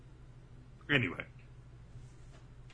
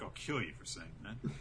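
mock threat; 6 semitones lower, 12 dB quieter than usual. Rindo threatening, voiced low.

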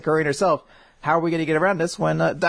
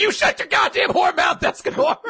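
much higher, louder furious shout, breaking into a laugh; hammed-up screeching rebuke; mock furious yell; 3 dB louder than usual. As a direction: snap at him to shut up. Merlin yells at Escanor.

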